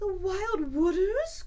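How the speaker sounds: high, slow, loud terrified cry; fearful cry. Acted. Toad terrified by the news, high and loud. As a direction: shriek it in dread. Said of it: "cried Toad, trembling".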